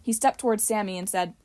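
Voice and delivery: ordinary delivery, nothing marked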